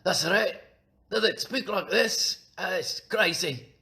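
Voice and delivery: high, loud mock Scottish brogue; hammed-up Scottish exclaiming; over-the-top Scottish accent. Shown as a demonstration. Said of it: "do Scottish people really speak that over the top".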